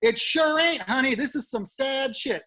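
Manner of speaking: bartender yelling out. Retold or imitated. Bartender heckling the bombing comic, loud and high. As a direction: call out from the bar, teasing. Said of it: "yells out".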